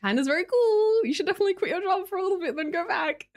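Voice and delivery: a mild mock-enthusiast voice; imitating travel streamers. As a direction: parody an overexcited travel vlogger. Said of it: "watch people like".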